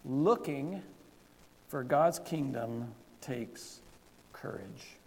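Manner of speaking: slow, spaced out for note-takers; slow dictation. Shown as a demonstration. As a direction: dictate each word for the blanks. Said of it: "here's more answers to the blanks".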